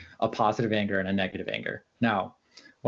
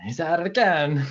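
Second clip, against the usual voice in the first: higher-pitched, lightly acted brush-off; mock dismissive; 5 semitones higher than usual. Someone excusing a single wrongdoer, mock dismissive. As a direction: imitate someone shrugging off one bad apple. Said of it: "it's not like".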